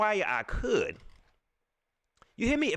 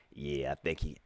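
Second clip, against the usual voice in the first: much lower, softer put-on trainer voice; Kevin impression; 8 dB quieter than usual. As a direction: drop way down to mimic the trainer. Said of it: "Kevin don't even talk like that".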